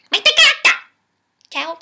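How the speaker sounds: high, loud shouted insult; parting insult shout. Acted. Puppet shouts a parting insult at Zupla, high and loud. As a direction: fling the insult on the way out.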